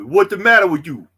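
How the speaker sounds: loud, high New York needling; New York accent. Pretend. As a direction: jokingly heckle Shelby in a New York accent. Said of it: "my New York accent's getting better".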